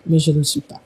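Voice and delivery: normal talking voice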